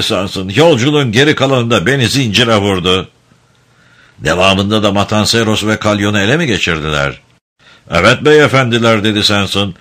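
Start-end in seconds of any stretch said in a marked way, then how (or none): none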